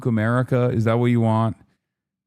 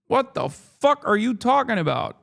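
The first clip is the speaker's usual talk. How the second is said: incredulous retort; 10 semitones higher than usual. Retold or imitated. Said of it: "they go".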